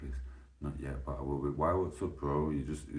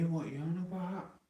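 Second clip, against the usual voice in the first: incredulous. Real, not acted. Incredulous reaction to [name].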